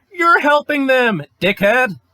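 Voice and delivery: loud, high angry outburst; rising to a real angry shout; furious. Real, not acted. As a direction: yell the accusation right at them. Angry rant climax.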